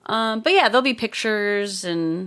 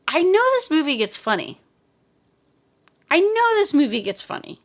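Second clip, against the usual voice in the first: higher, slightly louder mom voice; mom's excited reassurance; 7 semitones higher, 3 dB louder than usual. Retold or imitated. Kids begging, mom insisting the movie gets funny. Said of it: "my mom is going".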